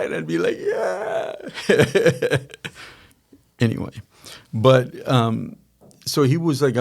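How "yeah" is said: laughing mock yeah. Retold own reaction, laughing.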